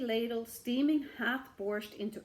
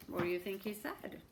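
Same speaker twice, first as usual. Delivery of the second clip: usual speaking voice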